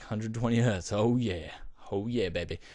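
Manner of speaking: gloating rub-it-in. Retold or imitated. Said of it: "you have to rub it in".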